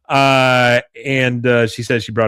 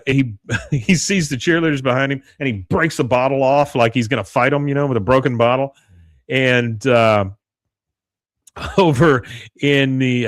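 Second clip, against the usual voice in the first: usual speaking voice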